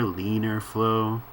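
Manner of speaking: ordinary talk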